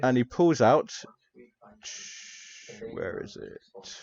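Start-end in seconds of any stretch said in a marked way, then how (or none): 2.9-4.0 a whispered mutter while searching a desk; slow, whispered search under the breath; whispered aside to himself. Acted. Professor rummaging in his desk.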